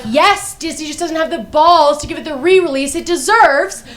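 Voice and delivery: high-pitched comic indignation; indignant retort. Acted. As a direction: insist hotly, voice pushed high. Acted indignant retort to the doctor.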